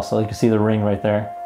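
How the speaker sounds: ordinary talk, nothing marked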